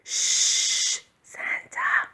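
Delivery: unvoiced hushing shh; whisper. A narrator's reading. Snowman hushing Santa in the yard, whispered. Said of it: "whispered the snowman".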